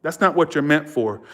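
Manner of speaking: ordinary delivery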